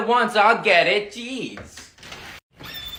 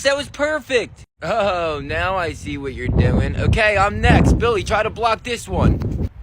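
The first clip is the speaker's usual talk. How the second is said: usual speaking voice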